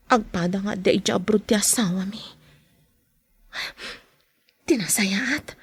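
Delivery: whispered aside, voice dropped out; secret whisper. Acted. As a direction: confide it under your breath. Secret aside about the husbands. (agarasaas a nalimed)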